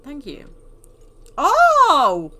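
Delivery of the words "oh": loud, high "oh"; big drawn-out cry of surprise; surprised exclamation. Real, not acted. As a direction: burst out in sudden realisation. Exclaims at a discovery.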